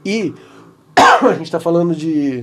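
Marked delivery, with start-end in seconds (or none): 1.3-2.4 coughs, then talks on; dry cough. Real, not acted. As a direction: cough before speaking. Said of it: "desculpa essa tosse aqui".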